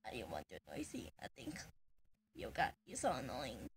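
a soft voiceless whisper. Real, not acted. Teasing Yoga under the breath, whispered.

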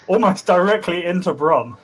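usual speaking voice

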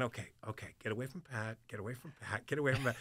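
inner thought. Retold or imitated. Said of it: "I'm immediately thinking".

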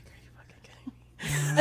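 slow, hushed, disbelieving whisper; stunned whisper. Retold or imitated. Stunned coworkers in the break room, whispering.

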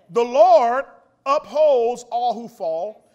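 loud, slow, drawn-out pulpit reading; preaching at full force. Acted. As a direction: proclaim the verse to the congregation. Preaches the psalm aloud.

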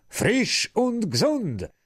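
put-on high, slow, chanted children's shout; shouted greeting. Children swarming the old madam, chanting high and slow. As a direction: chant the Easter greeting at her.

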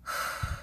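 long, soft exhale; weary sigh. Real, not acted. Vlogger pausing to catch breath, soft sigh. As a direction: let out a quiet breath to settle.

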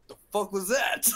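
loud, high, incredulous mock outburst; imitated baffled drill instructor. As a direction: demand it through a laugh. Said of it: "And then he's like".

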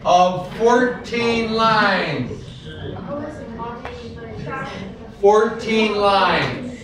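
fourteen said slowly, stressing each syllable; slow and stressed. Shown as a demonstration. Teacher drilling fourteen lines to the student. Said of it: "A sonnet is an English poem".

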